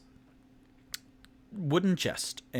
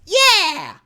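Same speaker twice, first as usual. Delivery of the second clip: high, loud cheer; excited yell; 16 dB louder than usual. Acted. Tipsy copying Toot Toot's gesturing, cheering loud. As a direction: whoop it out. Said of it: "And then Tipsy goes".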